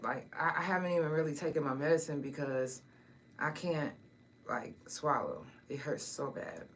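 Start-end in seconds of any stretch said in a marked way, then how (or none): none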